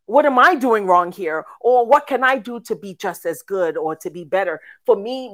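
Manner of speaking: usual speaking voice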